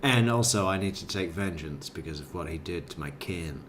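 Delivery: low, hushed mock vow of vengeance; Chris McNabb impression. Host embellishing the suspect's statement, low and quiet. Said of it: "Chris McNabb's over here saying".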